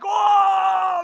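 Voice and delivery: high, loud mock crowd gasp; crowd's loud ooh. Storyteller imitating the crowd howling at the insults. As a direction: belt out the villagers' reaction.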